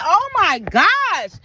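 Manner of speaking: high, loud, drawn-out dismay; imitating someone aghast; mock alarm. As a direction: stretch it out in exaggerated shock. Acts alarmed reaction to broken water.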